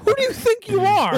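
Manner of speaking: high, hammed-up demand; mock dramatic line. Bruce confronting Selina, high hammed-up mock demand. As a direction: toss it up high, mocking the line.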